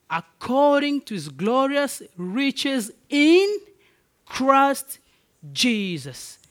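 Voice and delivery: stretched, ringing pulpit climax; full-force preaching. Acted. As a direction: drive the scripture home to the family.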